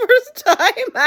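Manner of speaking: high, loud wail into laughter; wailing reaction. Real, not acted. Viewer reacting to the baby mom's name blunder, wailing. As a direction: break into a loud, pained cackle.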